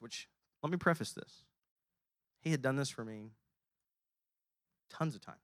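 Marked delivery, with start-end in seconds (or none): none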